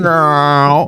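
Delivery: No drawn out into a garbled murmur; mimicked mumble. Host mimicking the caller who hung up, mock mumble. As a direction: slur No into one long indistinct sound. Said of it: "He said".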